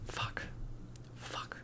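breathy whispered swear under the breath; whispered curse. Real, not acted. Losing his thread mid-commentary, whispered curse. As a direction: whisper it to yourself, annoyed. Loses train of thought, swears.

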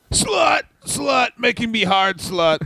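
high mock yell, falling away; Barry Darsow. Podcaster doing a wrestler's promo, mock yelling. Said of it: "And he just yells that a lot".

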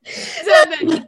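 high and slow through laughter; laughing. Real, not acted. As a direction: drag it out while laughing. Laughing, asks to wait. (riendo, muy agudo)

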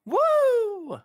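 high, loud whoop; excited woo for the five-star rating; cheer. Real, not acted. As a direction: shout for joy at the news. Cheering five-star podcast news.

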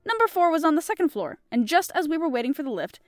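measured audiobook reading with character voices; storybook reading voice; story narration. A narrator's reading. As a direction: tell the tale steadily to listeners. Reads the story aloud.

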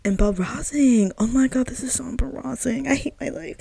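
hammed-up embarrassment, reliving the moment; retold mortified groan. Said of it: "And I was like".

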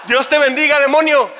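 laughing mock translation. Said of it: "o sea".

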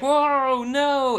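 high, loud imitated yelp; hammed-up startled whoa no; mock alarmed cry. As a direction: yelp it like you're flying backward. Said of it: "he's like".

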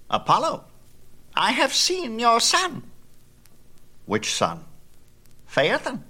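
high, light bird voice; a gossiping spy bird reporting to her master; high bird voice, sly; sun hawk. A narrator's reading. Sun hawk speaks to Apollo.

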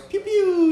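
high, loud mock gun sounds "pew, pew"; gunshot noises. Enemy turning around, mimicking gunfire with high mock shots. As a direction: spit out the shots like a toy gun. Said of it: "he turns around and goes".

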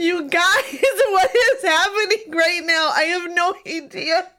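high, tearful laughing wail; bewildered, laughing through real tears; overwhelmed cry to the audience; crying with joy. Real, not acted. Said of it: "I can't stop crying".

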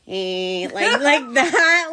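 lightly imitated dim cartoon snicker; a hint of a doofus cartoon laugh; Beavis and Butthead. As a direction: mimic his goofy cartoon laugh. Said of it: "reminded me, like, of Beavis and Butthead".

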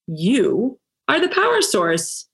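ordinary talk, nothing marked

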